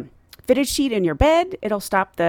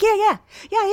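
high, louder, eager yeahs; higher and louder, eager yeah yeah yeah; 11 semitones higher, 4 dB louder than usual. Retold or imitated. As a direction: bubble over with eagerness. Eager reply.

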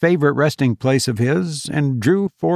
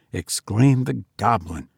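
ordinary delivery

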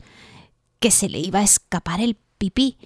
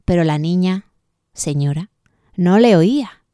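ordinary delivery, nothing marked

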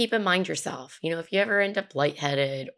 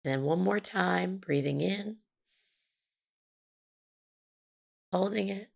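guided meditation. Acted. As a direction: gently guide the breathing, unhurried. Leads a mindful breathing exercise.

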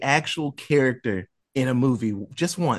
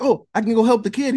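quick, bright and a bit louder; jumping at the chance to help; eager Mike; 8 semitones higher, 4 dB louder, faster than usual. Retold or imitated. Mike offering to rescue the kid upstairs, fast and eager.

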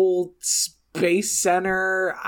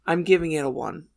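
ordinary delivery

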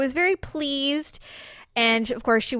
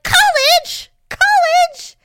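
much higher, louder sports-fan yell; imitating stepdad shouting at college ball; cheering at the TV; 8 dB louder, much slower than usual. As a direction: holler at the game like a fan. Stepdad yelling at football on TV.

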